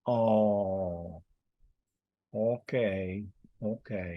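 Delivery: ordinary delivery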